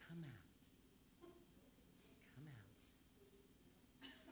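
barely audible whispered command. Acted. Jesus quietly telling the devil to leave, whispered command. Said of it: "if he did whisper".

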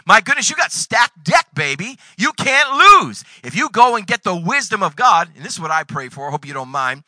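usual speaking voice

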